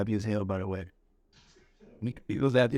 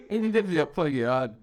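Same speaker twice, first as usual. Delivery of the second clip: normal talking voice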